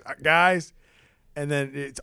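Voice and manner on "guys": louder, higher call for attention; mock post-game "guys"; imitating coach's speech. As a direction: lightly mimic a coach addressing the team. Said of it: "it's post-game speech".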